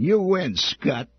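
a high, loud sneering snarl. A narrator's reading. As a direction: spit it at the victim like a sidekick. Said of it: "Dill snarled".

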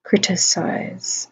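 "Criticize" drawn out to hear each sound; "Criticize" stretched out thoughtfully; slow checking. Shown as a demonstration. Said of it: "That should be in an I".

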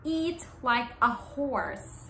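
slow, clear eat like a horse; slow idiom reading. Shown as a demonstration. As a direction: present the idiom slowly. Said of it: "Idiom of the day is".

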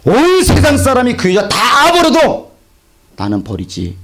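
slow, high proclamation fading to a whisper; God's voice proclaimed. Acted. Preacher to congregation, God's word proclaimed then whispered. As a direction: declare it, then let it fall to a breath.

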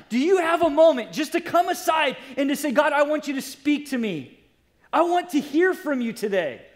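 building to a high, urgent appeal; impassioned preaching. Real, not acted. Preacher urging busy congregation to hear God, building urgency. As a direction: drive the challenge home from the pulpit.

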